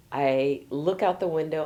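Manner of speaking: ordinary delivery, nothing marked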